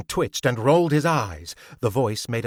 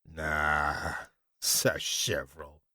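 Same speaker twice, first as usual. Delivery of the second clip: lower, softer, dismissive drawl; scoffing thug; 7 semitones lower, 7 dB quieter, slower than usual. A narrator's reading. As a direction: brush him off low. George scoffs.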